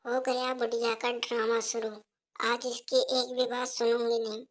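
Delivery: put-on soft, timid young wife offering tea; softly offering tea to her mother-in-law; meek daughter-in-law. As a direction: hand over the tea quietly and politely. Daughter-in-law thinks aloud.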